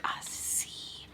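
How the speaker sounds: hushed unvoiced aside; whisper. Real, not acted. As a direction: breathe it out.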